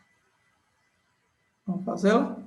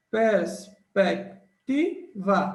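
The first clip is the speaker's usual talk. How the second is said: syllable by syllable; much slower than usual. Shown as a demonstration. Teacher showing students the syllables, slow and emphatic. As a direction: spell out each syllable slowly for the class. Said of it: "Letra C é a nossa certa".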